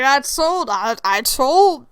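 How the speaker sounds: mildly stoned, drawn-out stammer; lightly voiced tipsy god reporting back; slurred high messenger. Stoned Hermes stumbling back with his message, mildly slurred. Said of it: "he's trying to straighten up".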